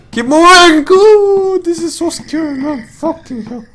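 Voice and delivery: high frightened voice; nervous, creeping through the dark; scared. Real, not acted. Creeping past monster in horror game.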